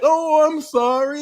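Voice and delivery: high-pitched cooing fake pity; mock sympathy. Host playing a gaslighter comforting a crier, fake sympathetic coo. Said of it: "you gaslight them".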